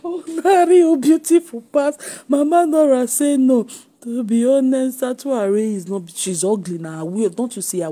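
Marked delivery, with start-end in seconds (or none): 0.0-3.7 high, loud mock wail; mocking imitation. Mimicking the woman in the clip for laughs, mocking imitation. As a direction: echo her complaint in a raised, teasing voice.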